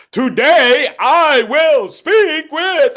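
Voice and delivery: shouted high and slow all through; loud power throughout, no focus; too loud. Shown as a demonstration. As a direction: blast every word with no focus. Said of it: "it sounds kinda like this".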